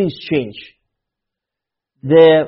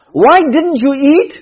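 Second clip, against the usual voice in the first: louder, higher, slow reproach; scolding parent; 5 semitones higher, 4 dB louder, slower than usual. Retold or imitated. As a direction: drag out the reproach.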